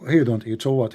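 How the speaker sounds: ordinary talk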